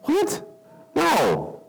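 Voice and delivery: sudden high squeak of shock; disbelieving what. Retold or imitated. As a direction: jump up in disbelief. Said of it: "You're going".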